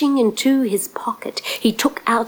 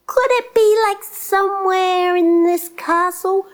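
coy guessing; 9 semitones higher than usual. A narrator's reading. Prince fishing for the giant's secret, high coy question.

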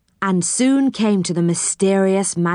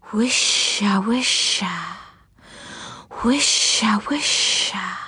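whispering trees; slower than usual. Acted. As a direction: breathe it like leaves in wind. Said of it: "whispered the trees".

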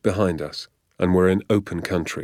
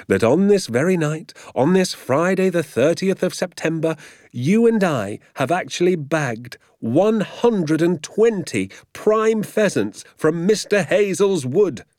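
high, grand, marvelling build-up; elated father; 8 semitones higher than usual. A narrator's reading. Father telling his son of their haul, elated. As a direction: announce the great haul with swelling pride. Father proudly totals the bag.

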